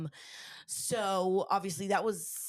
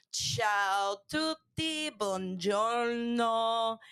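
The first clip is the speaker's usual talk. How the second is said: put-on Italian accent; 4 semitones higher, much slower than usual. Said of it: "It's your Italiani weirdy lingies".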